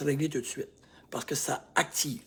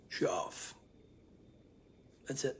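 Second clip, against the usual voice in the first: whispered, lower admission; quiet admission; 3 semitones lower, 13 dB quieter than usual. Shown as a demonstration. Coach modelling self-talk, whispered. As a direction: breathe it out softly. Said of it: "tu dis".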